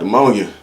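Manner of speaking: "pneumonia" said as "duh-MOAN-ya"; mispronounced pneumonia. Retold or imitated. Sick friend names his illness, saying duh-MOAN-ya. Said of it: "you think it's called the ammonia".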